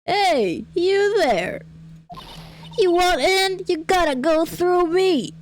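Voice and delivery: high-pitched feisty gatekeeper; unconvincing high old-lady voice; attempted old lady. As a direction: try out an old lady barring the way. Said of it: "how to make an old lady voice".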